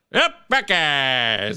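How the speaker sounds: slow, drawn-out announcer drawl; Keith Jackson Buckeyes line. Retold or imitated. Host falling back on his one Keith Jackson line. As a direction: stretch it like the old play-by-play man. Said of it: "I should just stick to".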